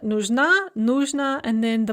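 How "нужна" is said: нужна stressed on the last syllable; stress on second syllable. Shown as a demonstration. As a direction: push the final на in нужна. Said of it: "Note the stress shift".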